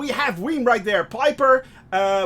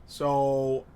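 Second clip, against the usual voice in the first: usual speaking voice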